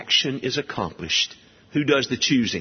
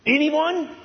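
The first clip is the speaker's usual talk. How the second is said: high, ringing dare to the room; Paul's defiance voiced by the preacher; defiant challenge; 14 semitones higher than usual. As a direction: call out and wait for no answer. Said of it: "That's the scene, the tone".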